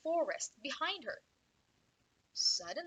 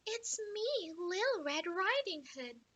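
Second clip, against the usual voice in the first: put-on little girl voice; 7 semitones higher than usual. Girl at the door.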